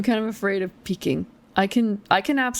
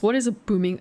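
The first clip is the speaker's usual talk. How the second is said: normal talking voice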